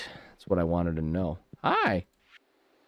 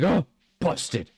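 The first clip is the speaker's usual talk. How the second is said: bird caught out; 8 semitones higher than usual. Retold or imitated. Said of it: "he's like".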